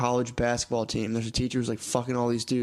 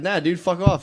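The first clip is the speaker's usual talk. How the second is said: louder, pitched-up brush-off; defiant retort; 6 semitones higher, 5 dB louder than usual. Retold or imitated. Retelling telling the boss to fuck off, louder. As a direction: bark back a cocky refusal. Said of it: "I was like".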